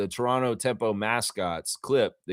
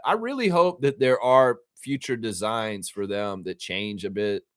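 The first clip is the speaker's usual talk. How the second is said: ordinary talk, nothing marked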